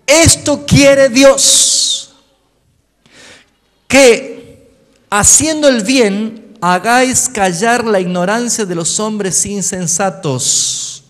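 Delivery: versículo proclamado fuerte y despacio; lectura predicada. Acted. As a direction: predica el texto con toda la fuerza. (lee el versículo a la iglesia, fuerte y lento)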